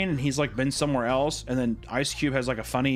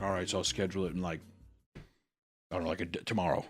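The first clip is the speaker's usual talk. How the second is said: low, soft, halting mumble; imitated casual self-talk, much quieter; mock offhand muttering; 8 semitones lower, 9 dB quieter than usual. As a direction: mumble it as if thinking aloud. Imitating man casually scheduling farewell email.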